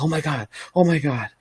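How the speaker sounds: high, breathless mock panic, retold; imitating a friend's frightened gasp of disbelief; freaking out. Retelling a teammate's panicked phone call, high mock panic. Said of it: "she's freaking out".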